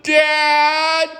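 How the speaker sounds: put-on high, drawn-out little girl's call; whimpering child. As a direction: imitate a small daughter whining for her father. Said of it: "you hear the whimper again".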